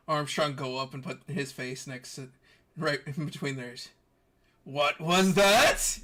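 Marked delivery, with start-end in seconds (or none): 4.6-6.0 drawn-out, loud nosy question; louder, higher, slow eavesdropper's interjection; booming intrusion. Acted. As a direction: butt in loudly, stretching the words. Armstrong butts into their whispering.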